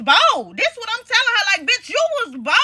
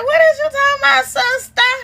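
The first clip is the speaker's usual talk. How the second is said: mock innocent sister; 7 semitones higher, 4 dB louder than usual. Sister voiced feigning innocence.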